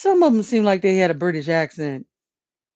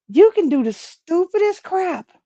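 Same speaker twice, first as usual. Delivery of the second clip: exasperated; 11 semitones higher than usual. Real, not acted. Exasperated outburst.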